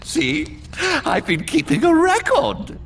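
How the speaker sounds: high-pitched, giddy pride; excited boast. Acted. Scribe shows the Spartan his record, high giddy boast. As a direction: show it off in a high, eager voice.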